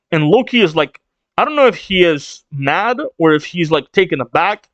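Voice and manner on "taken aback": ordinary delivery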